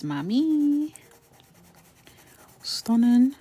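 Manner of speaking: high, loud, drawn-out praise; excited cheer. Real, not acted. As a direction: hype yourself up, big and slow. Cheering her finished look.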